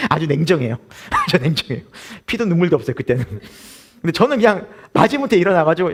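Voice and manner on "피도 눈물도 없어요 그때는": ordinary talk, nothing marked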